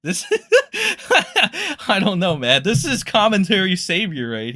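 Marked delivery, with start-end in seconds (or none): 0.3-1.8 high burst of laughter. Real, not acted. Laughing at the clip.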